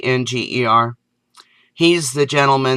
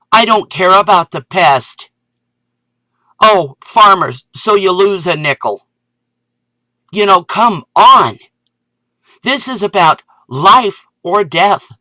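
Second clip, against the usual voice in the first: louder, higher angry outburst; real anger; 5 semitones higher, 5 dB louder than usual. Real, not acted. Ranting at farmers about poison corn, angry and loud. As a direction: let the anger boil over. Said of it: "I am really getting angry".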